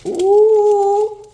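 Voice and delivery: high loud delighted ooh; excited squeal. Real, not acted. Steam from cut bread.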